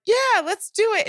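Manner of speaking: high, eager agreement; excited yes. Retold or imitated. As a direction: sound thrilled to go.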